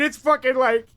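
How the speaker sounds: high, emotional voice close to tears; overwhelmed, half laughing, half crying; choked up. Real, not acted. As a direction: voice cracking with a laugh and a sob. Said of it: "I just start fucking crying".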